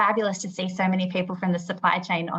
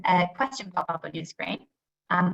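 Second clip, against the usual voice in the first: ordinary delivery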